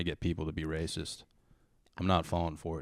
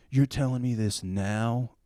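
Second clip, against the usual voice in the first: higher, slow incredulous outrage; retold outrage; 4 semitones higher, 3 dB louder, slower than usual. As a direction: imitate an angry driver, drawing it out. Tow driver lost his mind, retold.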